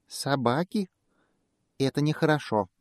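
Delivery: high, small squeaky mouse. Acted. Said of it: "пискнул мышонок".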